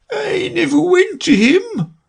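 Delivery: very high, slow weepy creature voice; sighing Mock Turtle. A narrator's reading. Mock Turtle sadly telling Alice and Gryphon, sighing. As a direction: sigh it out mournfully. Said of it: "said with a sigh".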